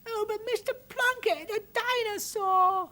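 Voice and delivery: put-on very high, pleading child's voice; little girl protesting, drawn out; urgent. A narrator's reading. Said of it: "said Arabelle urgently".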